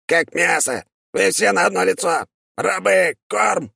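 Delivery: high contemptuous insect demon shriek; chittering insect creature. Acted. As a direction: spit it out like a chittering bug. Demon sneers at captor.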